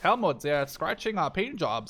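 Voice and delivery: put-on German accent. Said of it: "the best German accent I've ever done".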